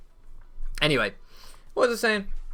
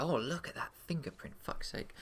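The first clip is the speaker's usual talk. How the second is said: muttered annoyance; 5 semitones higher, 12 dB quieter than usual. Retold or imitated. Annoyed at fingerprint quote.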